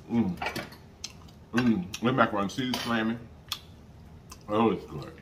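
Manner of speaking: chewing while talking; words through food; mouth full. Real, not acted. Seen 3: left speaker forks food, mouth working food while speaking.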